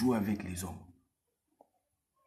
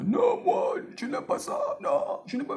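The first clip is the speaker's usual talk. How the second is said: sudden shout, much higher and louder; mock angry outburst; 5 dB louder than usual. Showing the angry man he shouldn't be, hammed-up shout. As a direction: bark it out like a man losing his temper.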